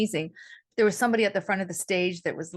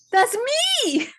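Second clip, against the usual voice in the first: retold shocked realisation; 13 semitones higher, 4 dB louder than usual.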